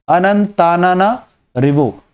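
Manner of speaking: अनंतानाना Revo spelled out slowly, syllable by syllable; slow, deliberate pronunciation of अनंतानाना Revo; slow careful naming. Shown as a demonstration. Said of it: "बड़ा अच्छा सा नाम है, बोलने में मजा आता है".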